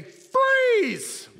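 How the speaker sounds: high, drawn-out full yell; screamed cop command. Acted. Kid cop bursting into the bedroom, screamed command. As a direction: yell it as loud as you can. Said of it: "scream as loud as they can".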